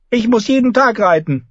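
put-on high, pouty child's protest; pouting boy. A narrator's reading. Boy king pouts to his mother about riding, high and sulky. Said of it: "schob die Unterlippe vor".

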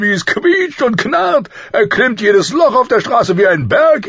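high, shouted comic rant; ranting king. A narrator's reading. King cursing the wheelhouse to his friend, ranting. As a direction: rage loudly and gleefully, building to a shout.

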